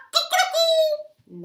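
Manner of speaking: very high silly bird cry; high cuckoo call. Acted. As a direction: squeal out a playful cuckoo.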